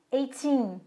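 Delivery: put-on "eighteen" with a drawn-out, stressed "teen"; "eighteen", weight on the last syllable; stress on the second syllable. Shown as a demonstration. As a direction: land firmly on the "teen" of "eighteen". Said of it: "a sílaba tônica tá no teen".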